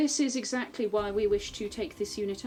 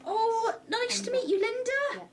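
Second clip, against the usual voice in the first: high-pitched greeting; slower than usual. Acted. Player greets Linda, voice raised.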